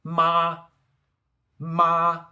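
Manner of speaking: long, loud, high level MA; first tone. Shown as a demonstration. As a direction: sing out MA on one high, flat pitch. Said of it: "go through the four tones again".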